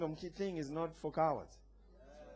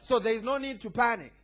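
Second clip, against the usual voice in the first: raised, emphatic preacher's voice; forceful preaching; 3 semitones higher, 8 dB louder than usual. Real, not acted. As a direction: preach it out with conviction, punching key words.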